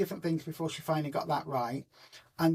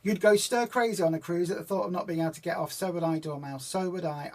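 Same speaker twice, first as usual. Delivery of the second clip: ordinary talk, nothing marked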